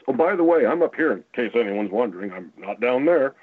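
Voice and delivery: high, loud mock Bush voice. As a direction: play Bush as a smug caller. Voicing Bush on the phone.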